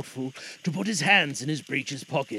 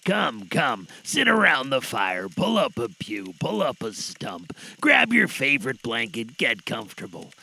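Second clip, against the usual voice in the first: cosy host welcome; 5 semitones lower than usual. Acted. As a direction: invite them in by the fire. Host welcomes listeners cosily.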